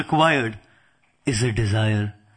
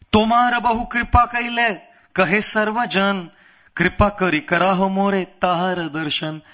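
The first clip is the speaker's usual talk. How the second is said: rapid, even scripture recitation, pitched up; verse recitation; 3 semitones higher, much faster than usual. Acted. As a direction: recite the verse quickly on a high, level note.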